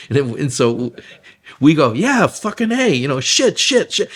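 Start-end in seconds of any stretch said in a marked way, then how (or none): 1.9-4.1 high, excited string of curses; retelling a gleeful swearing session; joyful swearing. Retold or imitated. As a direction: cut loose and curse for fun. Group swearing together, laughing.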